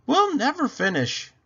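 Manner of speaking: high, loud lady of the house calling; Mrs Rogers voice. Acted. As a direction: call her name up high like the lady of the house.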